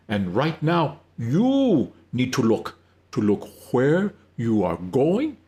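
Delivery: slow, every word stressed, gently firm; gentle but firm. Acted. Wyan to Elizabeth, warning her to look ahead, gentle but firm. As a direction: press each word home, kind but firm. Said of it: "emphasizing each word with a gentle but firm tone".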